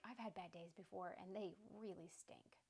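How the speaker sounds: whispered soft sympathy; soft whispered comfort to a friend; compassionate whisper. Retold or imitated. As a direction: breathe it out gently like a consoling friend. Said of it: "Them just giving compassion".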